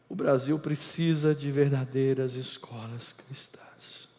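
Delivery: slow, hushed whisper. Real, not acted. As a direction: lean in and confide it. Confissão solene em sussurro. (sussurro lento e solene)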